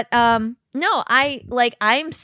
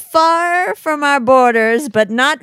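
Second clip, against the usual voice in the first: loud, put-on proclamation; mock town orator; 3 semitones higher, 9 dB louder than usual. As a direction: declaim to the whole town, hammed up. Acting out Who town meeting.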